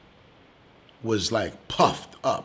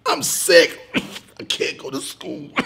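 higher, whimpering, fake-crying sick child; fake sick kid; 8 semitones higher, 5 dB louder, slower than usual. Boy acting sick to his pretend mother, hammed-up whimpering. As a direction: ham up being too sick for school. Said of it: "Hunter goes".

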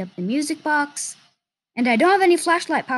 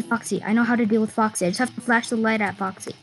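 usual speaking voice

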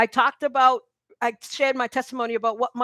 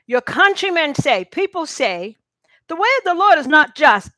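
emphatic reread; 4 semitones higher, 7 dB louder than usual. Shown as a demonstration. Preacher rereads the verse to the congregation, louder and higher. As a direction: read it again louder and higher, pressing on Lord. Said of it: "Let me read that again".

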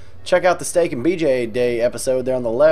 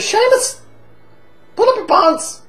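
much higher mock mother in an Irish accent; mom's scolding voice; 4 dB louder, slower than usual. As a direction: squeak it out like his scolding Irish mum. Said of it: "she said".

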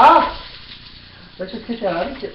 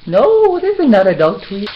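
high, louder no to the dog; loud mock scolding; 3 semitones higher, 7 dB louder than usual. Scolding her dog Lucy in a loud hammed-up voice.

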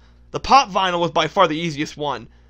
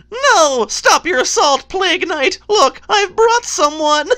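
high-pitched, urgent pleading voice; game character voice; 10 semitones higher, 4 dB louder, slower than usual. Acted. Character begging Plague Knight to stop his assault, urgent. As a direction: put on a high, drawn-out cartoon voice. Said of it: "now I'm gonna do the voices".

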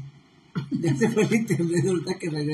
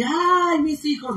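cliché Llorona cry; 6 semitones higher, slower than usual. Retold or imitated. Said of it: "pero no dice así".

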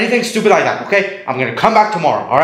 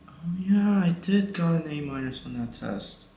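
quiet realization; 13 dB quieter than usual. Acted. Son remembering his test result, quietly. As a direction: admit it under your breath.

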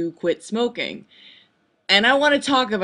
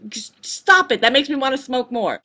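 exasperated; 6 semitones higher than usual. Retold or imitated. Snapping back at mom.

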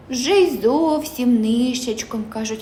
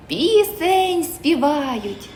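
usual speaking voice

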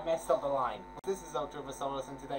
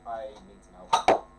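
ordinary delivery

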